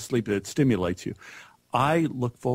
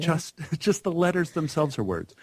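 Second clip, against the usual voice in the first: higher voice, laughing between phrases; amused laughing; 6 semitones higher than usual. Real, not acted. Guest recalling how easy the alphabet seemed, laughing. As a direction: chuckle through it at your own naivety.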